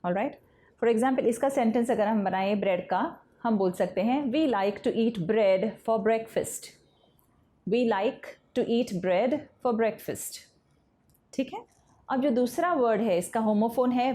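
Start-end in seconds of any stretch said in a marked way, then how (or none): 4.2-10.4 slow dictation. Shown as a demonstration. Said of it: "teacher reads the example sentence slowly".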